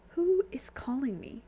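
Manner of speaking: soft unvoiced whisper. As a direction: whisper it, spooked. Character hears a mysterious voice.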